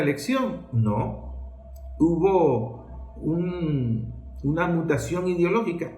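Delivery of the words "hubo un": ordinary delivery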